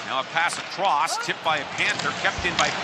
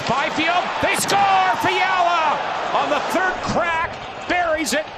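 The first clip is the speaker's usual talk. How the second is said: goal call; 4 semitones higher than usual. Real, not acted. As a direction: roar they score. Goal call by play-by-play announcer.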